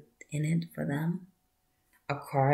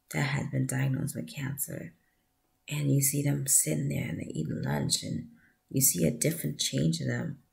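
ordinary delivery

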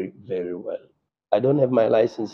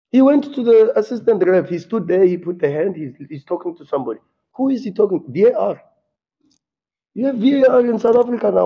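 raised, loud, heated voice pitched well up; angry rant; 7 semitones higher, 4 dB louder than usual. Real, not acted. Coach berating journalists about refereeing, furious raised voice. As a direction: let the frustration boil over at the press. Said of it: "I don't even know how to come back from that".

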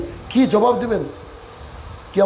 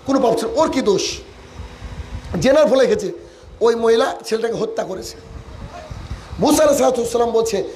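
ordinary talk

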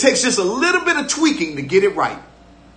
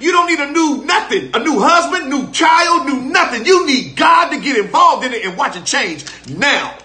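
full-force preaching; 4 semitones higher than usual. Acted. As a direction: preach it hard, building to a shout.

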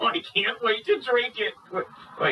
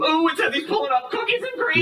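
high-pitched gleeful outburst; excited; 6 semitones higher than usual. Acted. Man sees his milkshake delivery arriving, excited. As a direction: burst out as the treat arrives.

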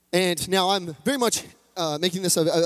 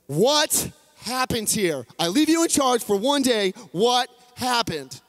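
upset father; 5 semitones higher, slower than usual. Acted. Father demands answers from son.

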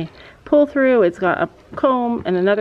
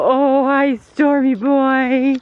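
slow, higher, drawn-out fond hello; cooing to horse; 4 semitones higher, 3 dB louder, much slower than usual. Real, not acted. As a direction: croon his name lovingly. Greeting second horse.

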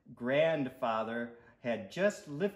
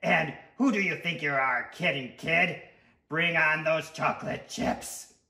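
loud, cocky character voice; sweet tooth voice; 3 semitones higher, 6 dB louder than usual. Acted. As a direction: talk back like a pushy little monster. Story figure: pushy tooth.

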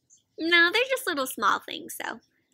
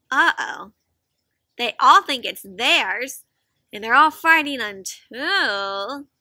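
ordinary delivery

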